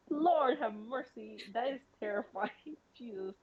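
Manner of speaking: usual speaking voice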